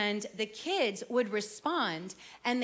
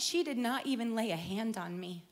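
normal talking voice

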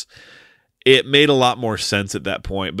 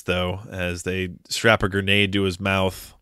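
ordinary talk

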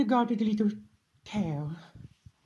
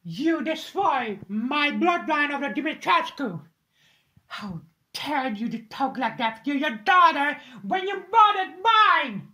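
loud shout climbing higher; furious accusation; 4 semitones higher, 5 dB louder than usual. Acted. Accusing Ethan of murdering her daughter, furious shout. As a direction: hurl the accusation at him.

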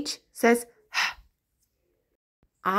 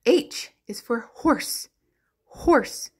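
normal talking voice

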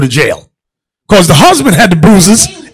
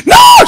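very high mock scream; fake scream; 6 dB louder than usual. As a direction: shriek it like a faked attack. Wife screaming on floor.

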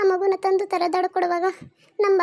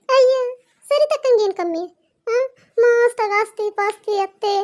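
slow, higher and louder dismissal; scoffing disbelief; 4 semitones higher, 4 dB louder, slower than usual. Acted. Neighbour scoffing that Saritha lacks nothing, slow, higher and louder. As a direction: wave away the worry with emphasis.

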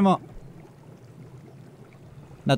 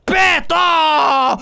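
sudden shout; 8 semitones higher, 5 dB louder than usual. Real, not acted.

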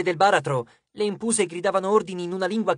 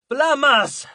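high, loud firing order; shouted command; 8 semitones higher, 5 dB louder than usual. A narrator's reading. Frank ordering zombie archers to fire, shouted. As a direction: cry the order across the ranks. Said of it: "gridò".

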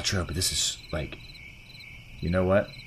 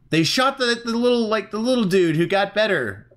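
shocked; 10 semitones higher than usual. Real, not acted. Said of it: "shocked by the ending".

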